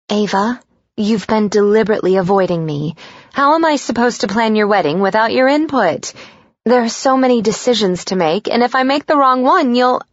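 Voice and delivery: high, anxious, pushy mom voice; a worried mother scolding her daughter; fretful mother. Acted.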